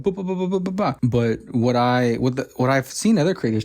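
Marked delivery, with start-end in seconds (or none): 0.0-1.0 robot boops. Retold or imitated. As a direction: chirp each boop like a little robot. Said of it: "watching him going".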